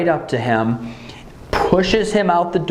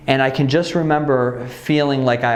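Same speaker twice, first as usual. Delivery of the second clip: ordinary delivery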